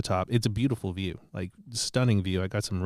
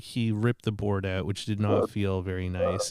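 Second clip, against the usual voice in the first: usual speaking voice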